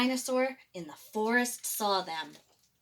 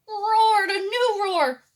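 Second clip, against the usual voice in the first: building roar; 10 semitones higher, 8 dB louder, slower than usual. A narrator's reading. Narration rising into the roar.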